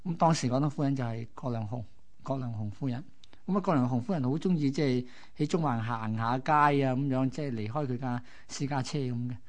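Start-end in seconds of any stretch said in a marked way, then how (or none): none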